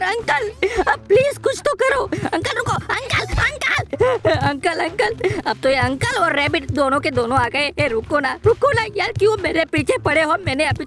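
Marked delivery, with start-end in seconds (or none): 0.0-5.4 desperate pleading. Real, not acted. Begging the alien uncle.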